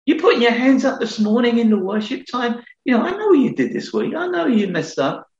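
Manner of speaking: high, needling accuser's voice; preacher voicing the devil's accusations, high and sneering; accusing devil. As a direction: taunt like the enemy who knows your sins. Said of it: "he comes in a different".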